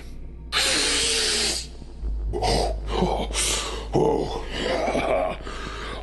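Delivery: a long, drawn-out falling sigh; long sigh fading away; long weary sigh. Acted. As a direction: let out a heavy sigh. Long sigh after toast to Jorge.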